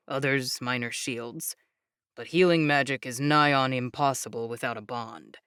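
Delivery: low, calm proud explainer voice; proud young man. Acted. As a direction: speak low and proudly steady. Jake explains.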